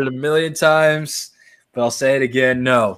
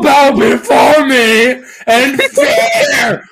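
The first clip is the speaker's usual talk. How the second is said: grand, slow, laughing tyrant proclamation; booming pharaoh; 8 semitones higher, 4 dB louder, slower than usual. Pretend. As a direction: declare it like a god-king, cracking up. Grand mock tyrant speech, laughing.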